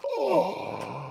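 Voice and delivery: high, soft drawn-in gasp; shocked gasp. Real, not acted. Friend hears he hasn't seen Baby Yoda show, shocked gasp. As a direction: gasp in disbelief.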